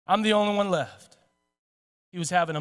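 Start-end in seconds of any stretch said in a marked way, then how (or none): 0.1-1.2 understated, resigned loneliness; lonely. Acted. Elijah having a moment of loneliness, subdued and forlorn. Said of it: "of loneliness".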